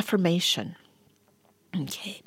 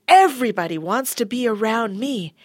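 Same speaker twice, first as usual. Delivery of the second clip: usual speaking voice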